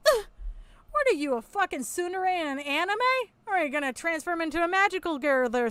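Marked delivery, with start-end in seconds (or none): none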